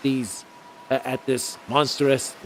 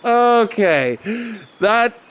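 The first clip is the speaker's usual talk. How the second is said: laughing; 7 semitones higher, 4 dB louder than usual. Real, not acted. Laughing at own outburst.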